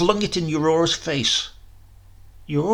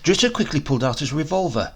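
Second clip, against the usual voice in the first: ordinary delivery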